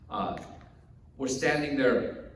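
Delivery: ordinary delivery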